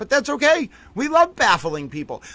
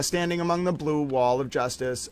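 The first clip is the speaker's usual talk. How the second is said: usual speaking voice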